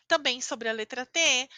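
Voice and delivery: ordinary talk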